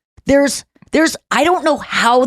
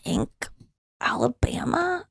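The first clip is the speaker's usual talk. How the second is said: unsure whispered aside; whispered half to herself; 2 semitones higher, 11 dB quieter than usual. Real, not acted. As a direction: breathe the name, unsure.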